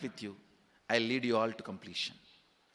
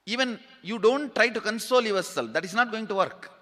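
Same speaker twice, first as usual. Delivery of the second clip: raised, urgent exhortation; passionate; 9 semitones higher, 5 dB louder than usual. Real, not acted. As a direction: push each line out with fervor. Guru urging listeners to break down.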